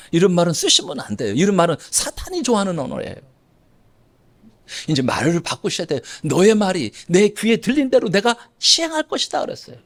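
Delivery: ordinary talk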